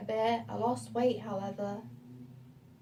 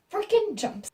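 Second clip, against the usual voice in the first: higher and louder burst; excited jolt; 8 semitones higher, 5 dB louder than usual. Real, not acted. Telling viewers about the gorilla mask, excited high burst. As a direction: relive the jump scare out loud.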